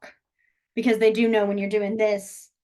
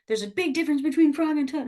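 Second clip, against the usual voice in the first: lightly mocking a fussy stickler, a bit higher; pedantic know-it-all; 5 semitones higher, faster than usual. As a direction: tease the nitpicker who insists on the difference. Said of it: "anyone who's a big, like".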